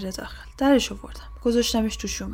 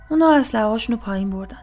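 plain reading voice

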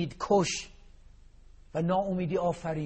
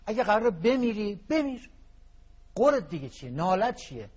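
mock scolding; 5 semitones higher, 3 dB louder than usual. Scolds an imagined complainer.

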